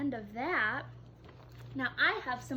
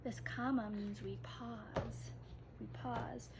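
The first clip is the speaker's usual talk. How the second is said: whisper; 2 semitones lower, 11 dB quieter than usual. Real, not acted. Teacher explains comma quietly.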